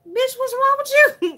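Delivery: high, sharp, put-on voice of the sister; sister's shocked voice. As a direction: squeal at her in shock. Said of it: "She said".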